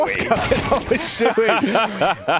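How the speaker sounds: laughing through the question, high and slow; amused laugh while casting. Real, not acted. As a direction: crack up mid-sentence. Caster laughing at a player's antics.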